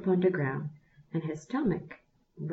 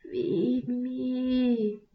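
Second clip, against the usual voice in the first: high, fading plea; the dying fire calls one last time, high and faint; last strength; 6 semitones higher than usual. A narrator's reading. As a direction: call with the last breath. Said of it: "with his last bit of strength".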